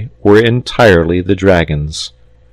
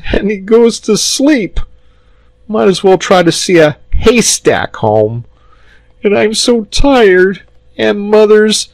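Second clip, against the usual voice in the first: put-on very high, whining child; acted wail of a worn-out little boy; close to breaking down; 14 semitones higher, 3 dB louder than usual. A narrator's reading. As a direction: pour out a tearful grievance. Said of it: "he broke down again".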